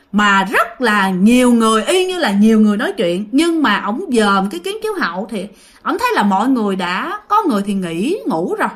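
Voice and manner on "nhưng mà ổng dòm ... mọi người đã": ordinary delivery, nothing marked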